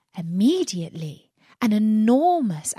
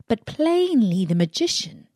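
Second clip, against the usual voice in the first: usual speaking voice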